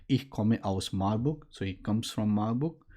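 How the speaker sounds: ordinary talk, nothing marked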